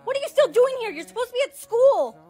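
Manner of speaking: high, startled reprimand; startled scolding. Acted. Parent finding daughter home from school, startled high scolding. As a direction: scold while still jolted.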